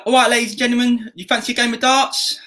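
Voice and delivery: high-pitched Essex patter; Essex accent. Shown as a demonstration. Games host calling players to darts, Essex accent.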